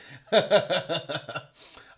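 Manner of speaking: rapid chuckling laugh; hearty chuckle. Real, not acted. Host joking to viewers about what he offers guests, chuckling. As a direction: chuckle at your own joke.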